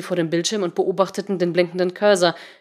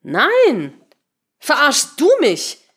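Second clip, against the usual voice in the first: high, loud, slow retort stressing "du"; indignant counter-question; 8 semitones higher, 6 dB louder, much slower than usual. A narrator's reading. Patricia turning the accusation back, indignant retort. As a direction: throw the question back hard on "du". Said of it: "fragte sie zurück".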